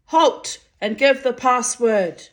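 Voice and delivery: a high, nervous sentry call; high trembling sentry. A narrator's reading. Sentry challenging riders in the dark, high and trembling. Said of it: "A high, trembling voice called out".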